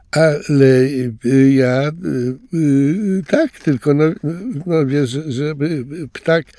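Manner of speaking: lightly voiced embarrassed stammer; hesitate, flustered. A narrator's reading. Louis stammers, flustered after being called friend. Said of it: "speszył się Louis".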